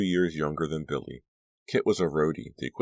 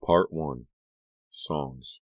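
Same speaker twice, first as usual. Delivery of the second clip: ordinary delivery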